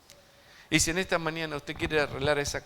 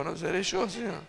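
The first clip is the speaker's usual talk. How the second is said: Seré yo said with Argentine sh for y; light Argentine accent, yo as sho; a hint of an Argentine disciple; 4 semitones higher than usual. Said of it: "con acento argentino uno dijo".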